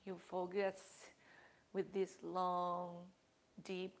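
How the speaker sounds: soft, hushed meditation voice with long pauses; guided meditation. Acted. Yoga teacher guiding the class through breathing, soft and hushed. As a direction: ease the class into calm breathing.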